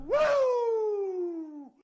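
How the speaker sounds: loud, high, long-held woo; celebratory woo. Pretend. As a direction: let out a drawn-out high "wooo!". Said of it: "One woo for you".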